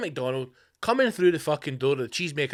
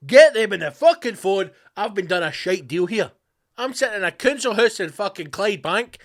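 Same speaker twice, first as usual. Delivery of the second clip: loud, raised-pitch indignant rant; mock outraged complaint; 4 semitones higher, 8 dB louder than usual. Jealous witness in a council house complaining, loud mock outrage. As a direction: burst out loudly, playing the aggrieved party.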